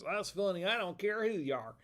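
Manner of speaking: a hint of a Southern comic catchphrase; Larry the Cable Guy. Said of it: "Larry the Cable Guy says".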